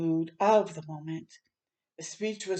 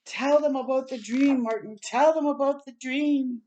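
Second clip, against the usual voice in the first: loud, higher, insistent call; shouting; 6 semitones higher, 4 dB louder than usual. Acted. Mahalia urging Martin from the platform, loud shout. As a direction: urge him on at full voice. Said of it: "Again she shouted".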